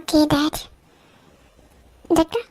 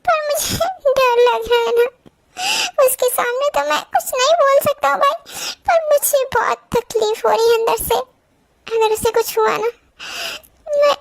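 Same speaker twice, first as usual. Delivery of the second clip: crying; 6 semitones higher than usual. Acted. Husband confides fear before surgery.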